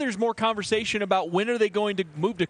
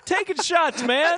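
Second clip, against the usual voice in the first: high-pitched laughter breaking into the words; can barely get it out for laughing; laughing hard; 11 semitones higher, 3 dB louder than usual. Real, not acted. Laughing at the guest's jab.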